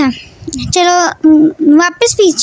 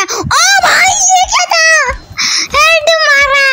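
high startled yell; panicking gamer; 8 semitones higher than usual. Real, not acted. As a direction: cry out in alarm at the screen. Sudden enemy, then headshot.

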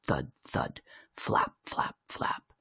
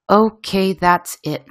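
mildly raised, exasperated voice; fed-up cry; 6 semitones higher, slower than usual. A narrator's reading. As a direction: put your foot down, pitched up a little. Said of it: "my mum cried".

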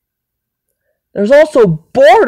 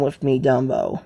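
low Dumbo voice; 8 semitones lower, 8 dB quieter than usual. Pretend. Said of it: "You don't talk like that".

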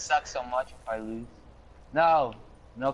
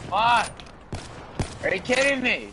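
frustrated outburst; 6 semitones higher than usual. Real, not acted. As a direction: rage at getting killed again. Killed again in game.

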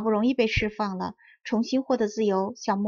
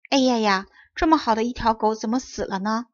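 usual speaking voice

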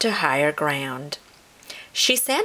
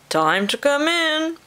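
high, singsong call to come in; 11 semitones higher, 3 dB louder than usual. A narrator's reading. Possum father to his baby possums, calling out. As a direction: call the little ones in, pitch rising. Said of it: "he called to his baby possums".

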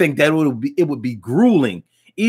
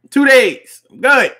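emphatic; 9 semitones higher, 7 dB louder, slower than usual. Real, not acted. Emphatic advice.

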